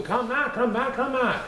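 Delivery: high calling yell; old couple calling him back; calling after him. Acted. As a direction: shout after the runaway cookie. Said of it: "were yelling too".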